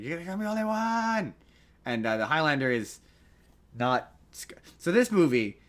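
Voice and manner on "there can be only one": high, held squeal at normal volume; restrained high mock scream, last word stretched; Lindsay Lohan. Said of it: "do it like Lindsay Lohan".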